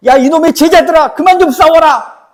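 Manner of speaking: loud, high-pitched shouted rebuke; mock scolding. Preacher acting out Jesus rebuking the quarrelling disciples, loud scolding shout. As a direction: bellow it like an exasperated master scolding his followers. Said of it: "이렇게 꾸짖고 계시나요".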